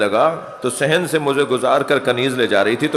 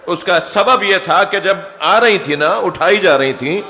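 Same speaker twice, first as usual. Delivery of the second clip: ordinary talk, nothing marked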